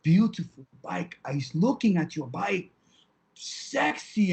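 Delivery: lightly imitated Russian accent; slow Russian woman accent. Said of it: "in her Russian accent".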